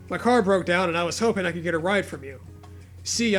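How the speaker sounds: normal talking voice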